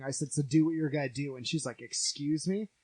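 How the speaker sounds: normal talking voice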